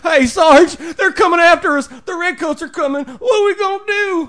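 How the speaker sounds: high, loud hammed-up panic; panicked soldier. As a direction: shriek for orders from your sergeant. Panicked soldier runs to sergeant.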